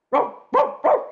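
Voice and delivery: loud, high imitation dog barks; barking dog. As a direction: woof like a noisy dog demanding attention. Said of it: "says your dog".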